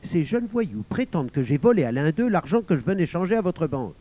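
loud, mildly enraged explanation; trembling with rage. A narrator's reading. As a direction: complain angrily about an accusation. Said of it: "tremblant de rage".

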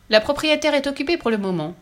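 put-on high girlish announcement; cheeky Fifi. A narrator's reading. Fifi fobs off the gentleman while playing, cheekily.

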